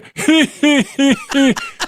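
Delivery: high, raspy put-on cackle; Tony Tedesco. Shown as a demonstration. Co-host mimicking the producer, squeaky cackle. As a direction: wheeze out a high character laugh. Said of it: "that's my Tony Tedesco impression".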